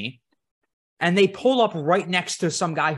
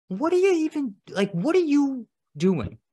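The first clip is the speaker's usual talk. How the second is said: incredulous; 9 semitones higher than usual. Retold or imitated. Imitating incredulous self.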